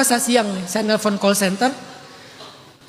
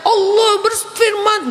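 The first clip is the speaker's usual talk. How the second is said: much higher, slow, booming preacher shout; mock orator shout; 10 semitones higher, 3 dB louder, slower than usual. Preacher trying a tabligh akbar style, mock high slow shout. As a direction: thunder it out like a mass rally preacher. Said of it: "Nggak bisa saya".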